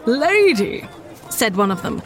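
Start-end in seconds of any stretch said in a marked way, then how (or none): 0.1-0.9 high, disdainful gentleman's voice; haughty gentleman. A narrator's reading. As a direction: scoff like an entitled gentleman. Scornful gentleman in story.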